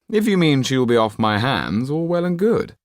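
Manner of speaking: high, loud, careless aristocrat; callous Marquis. A narrator's reading. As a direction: toss the daughter aside with contempt. Marquis voiced.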